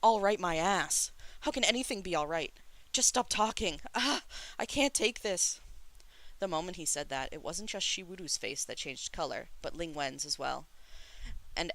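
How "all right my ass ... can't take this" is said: high, loud and distraught; angry outburst. A narrator's reading. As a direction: lash out, at the end of your tether. Distraught brother's outburst in a quarrel.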